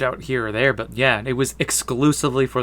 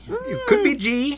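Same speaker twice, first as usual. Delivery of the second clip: silly high voice; slower than usual. Pretend.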